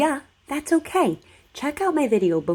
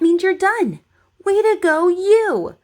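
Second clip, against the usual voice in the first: excited praise; 8 semitones higher, 5 dB louder than usual. Real, not acted. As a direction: cheer them on with big energy. Cheering the child at the end.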